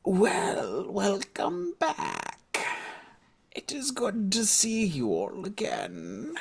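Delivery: put-on high, slow old granny voice; a frail grandmother greeting her family. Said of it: "I don't remember her voice".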